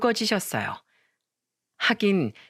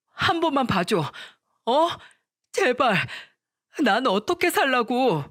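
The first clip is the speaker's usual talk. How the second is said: pitched-up, distressed plea; cling and beg for one more chance; desperate begging; 3 semitones higher than usual. A narrator's reading. Boyfriend pleading with his bride for forgiveness, desperate. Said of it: "남자친구는 무릎을 꿇고 매달렸어요".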